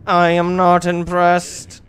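loud, slow drawn-out creature voice; mock unimpressed. Player voicing the unimpressed game creature, slow and mocking. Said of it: "He's like".

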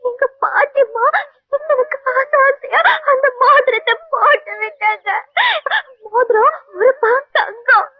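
sobbing out the story, voice rising; tearful, slow sobbing complaint; crying. Acted. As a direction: wail about the lost ring. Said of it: "அழுகாதீங்க".

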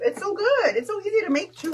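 high and loud, gushing over the soup; bubbly food-lover enthusiasm; excited praise. Real, not acted. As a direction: rave about it like you can't help it. Raving about soup.

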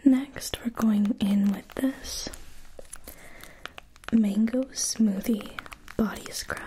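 ordinary talk

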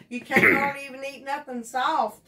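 ordinary talk

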